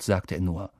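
usual speaking voice

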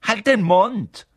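high, loud, drawn-out shut-up; annoyed retort. A narrator's reading. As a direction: tell the teasers off. Teased boy snaps back.

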